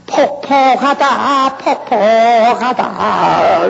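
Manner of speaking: high, slow wail on 폭폭하다; mock ghost wail. Preacher mimics a Gochang ghost, high slow wail. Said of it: "고창 쪽으로 가면은".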